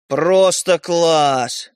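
very slow, drawn-out mock praise; sarcastic. Brother reacting to the given-away toy, sarcastic. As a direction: stretch the praise out in disgust.

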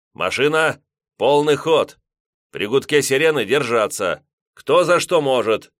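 loud, high battle order; battle shout. A narrator's reading. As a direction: roar it over the engines. Captain commands full speed.

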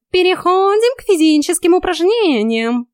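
high, loud coach-like command; exclaiming cat. A narrator's reading. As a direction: announce it brightly as a bossy cat. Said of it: "воскликнул тот".

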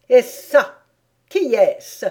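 loud, high imperious question; demanding queen. A narrator's reading. Queen questions who the gardeners are, loud and demanding. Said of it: "demanda-t-elle encore".

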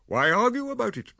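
breezy mock-cheerful jab; gaily. A narrator's reading. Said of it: "he said gaily".

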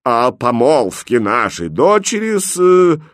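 slow, solemn announcement; proclaim, then stall searching for the name; ceremonial, drawn-out hesitation; halting proclamation. A narrator's reading. King resumes tragic announcement, falters.

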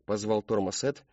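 ordinary delivery, nothing marked

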